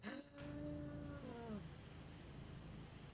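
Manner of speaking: long, soft drawn-out sigh; weary sigh. Real, not acted. Needing a lie down after the car trip, weary sigh. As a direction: let out a long weary breath.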